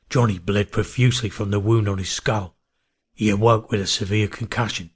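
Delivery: ordinary delivery, nothing marked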